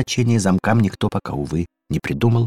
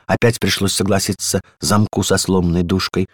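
ordinary delivery, nothing marked

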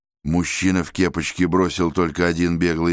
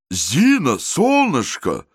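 slow, louder call down the corridor; 8 semitones higher, 3 dB louder, slower than usual. Acted. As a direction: call to his wife in the hall. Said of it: "крикнул он в коридор".

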